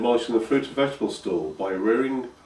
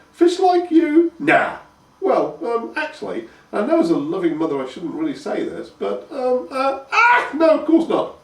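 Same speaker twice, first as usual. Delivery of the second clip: flustered mother; 13 semitones higher, 5 dB louder than usual. A narrator's reading. Mother dodging the fish question with her son. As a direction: dither and backpedal awkwardly.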